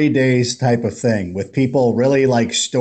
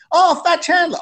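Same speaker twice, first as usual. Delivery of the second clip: excited greeting. Retold or imitated. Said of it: "people would stop me".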